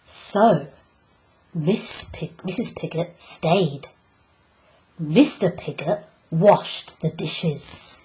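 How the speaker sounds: ordinary delivery, nothing marked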